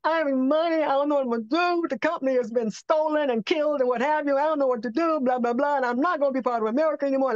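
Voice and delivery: high, pleading desperate prayer; retold tearful plea to God; pleading cry. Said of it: "I'm crying".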